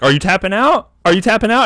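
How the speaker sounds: high, loud rising question; retold urgent question. Asking the choked rival slapping his hands, high mock urgency. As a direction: act out demanding whether he gives up.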